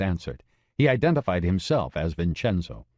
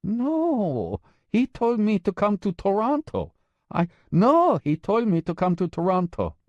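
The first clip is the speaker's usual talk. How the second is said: flustered insisting woman; 12 semitones higher than usual. Acted.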